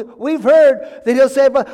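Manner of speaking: loud, high, drawn-out assurance; emphatic insistence. Acted. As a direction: insist with stretched, eager conviction. Advisors insist he can save them.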